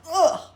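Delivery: sharp high loud cry of being squeezed; a squeezed yelp; loud high strangled grunt; strangled ugh. Acted. Squeezed by the snake.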